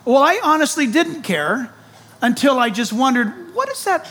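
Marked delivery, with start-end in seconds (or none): 3.5-4.1 high, wondering question; curious wonder. Retold or imitated. Preacher reenacting his own puzzled discovery, high pitched. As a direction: ask it like you've just noticed.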